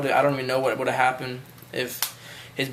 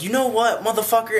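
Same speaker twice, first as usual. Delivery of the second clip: higher-pitched retold angry cussing; 8 semitones higher than usual. Friend's dad turns on the teenage guest, retold angry cussing. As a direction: imitate an angry dad starting to cuss. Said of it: "he starts cussing at".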